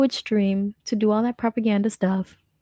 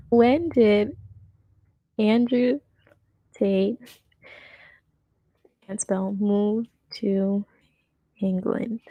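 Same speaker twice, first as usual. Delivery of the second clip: word by word, gaps between; spaced out as if typing a search; typing aloud; much slower than usual. Real, not acted.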